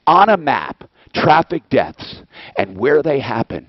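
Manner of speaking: ordinary talk, nothing marked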